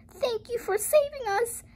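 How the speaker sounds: very high, thin-volume thanks; high-pitched storybook child; little girl crying out. A narrator's reading. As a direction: squeak it up as a grateful little girl. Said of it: "cried the little girl".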